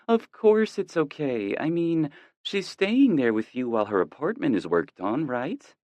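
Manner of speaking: a mom relieved and chuckling; relaxed mother. A narrator's reading. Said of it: "she chuckles... hear her relax".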